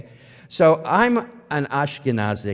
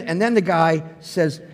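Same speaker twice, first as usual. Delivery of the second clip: normal talking voice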